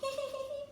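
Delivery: tiny high-pitched leprechaun giggle; a soft, high, mischievous titter; high little giggle. A narrator's reading. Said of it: "they heard a little sort of laughter".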